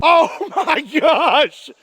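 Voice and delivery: shrieking through big laughter; laughing. Real, not acted. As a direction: laugh it out, high and loud. Reacting to the shot result.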